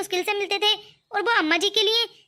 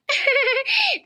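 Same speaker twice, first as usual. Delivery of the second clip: nervous laugh; 5 semitones higher than usual. Acted. Bahu caught, laughs it off.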